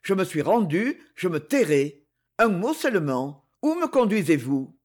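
ordinary delivery, nothing marked

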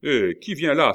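high, loud sentry-like challenge; shouted challenge. A narrator's reading. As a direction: call out to whoever is coming. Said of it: "s'écria Coconas". (interpellation criée)

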